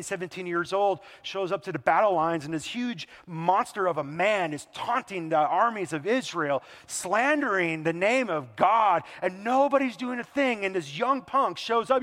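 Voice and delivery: ordinary delivery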